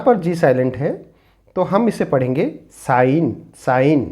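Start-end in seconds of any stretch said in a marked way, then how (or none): 2.9-4.0 "sign" said as "sine", G not sounded; "sign" spoken slowly with a silent G. Shown as a demonstration. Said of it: "When G is silent". ("sign" में G silent, "साइन" बोलें)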